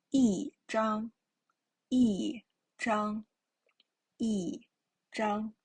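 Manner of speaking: 一张 as yìzhāng, 一 falling, then split slowly; teacher modelling 一张; fourth tone yi. Shown as a demonstration. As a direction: model 一张 syllable by syllable. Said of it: "Zhang is a first time character".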